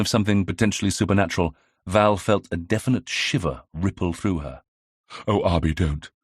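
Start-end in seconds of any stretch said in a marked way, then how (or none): none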